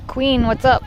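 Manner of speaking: usual speaking voice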